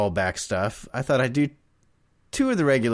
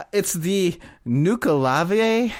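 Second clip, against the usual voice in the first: attempted Scottish accent; 7 semitones higher, much slower than usual. Shown as a demonstration. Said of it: "See, I can't do a Scottish accent".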